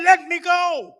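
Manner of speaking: high, loud cry of release; shouted plea. Retold or imitated. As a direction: cry it out as she struggles. Dying woman struggling, shouted.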